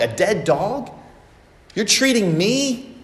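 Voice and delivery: a stunned man who can't believe the kindness; shocked disbelief. Acted. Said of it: "He's amazed".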